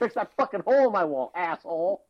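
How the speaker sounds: hammed-up angry complaint about the wall; mock angry yell. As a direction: yell at the guy who broke the wall. Yelling at Kool-Aid Man.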